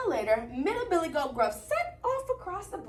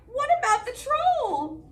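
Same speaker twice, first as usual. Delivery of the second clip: high, louder frightened goat; worried goat; 9 semitones higher, 5 dB louder than usual. Acted. Middle goat warning brothers, high and scared. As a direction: fret about the troll. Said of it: "cried Middle Billy Goat Gruff".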